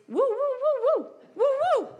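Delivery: very high, loud woo woo yelps; golden retriever noise. Retold or imitated. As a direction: yelp like an eager dog. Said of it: "I sound like a golden retriever".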